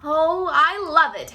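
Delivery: loud, slow delighted exclamation; exclaimed. A narrator's reading. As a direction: gush over the tooth. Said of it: "exclaimed the fox".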